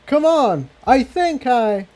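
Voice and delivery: high character voice, slowing as it is cut off; calling out. Acted. Jigsaw turning away, calling the mares to follow. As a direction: urge the others on, then trail off. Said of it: "called out".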